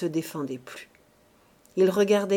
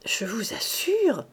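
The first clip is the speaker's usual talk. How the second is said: gentle voice; 3 semitones higher, slower than usual. A narrator's reading. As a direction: plead it gently, drawing it out. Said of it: "répéta Mouret d'une voix douce".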